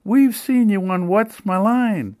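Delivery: put-on high, slow eager elderly lady; chiming old lady. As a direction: chime in like a thrilled old fan. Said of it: "a different lady's voice chimed in".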